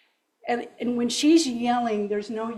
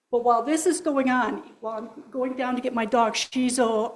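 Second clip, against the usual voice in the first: ordinary talk, nothing marked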